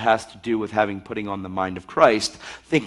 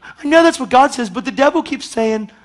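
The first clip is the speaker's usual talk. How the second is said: acted-out doubter; 13 semitones higher, 7 dB louder than usual. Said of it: "I'm acting it out".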